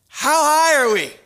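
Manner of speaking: loud, high-pitched shouted question; yelling over noise. Retold or imitated. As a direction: shout it over the plane's engines. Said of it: "you have to yell pretty loud".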